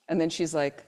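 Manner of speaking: normal talking voice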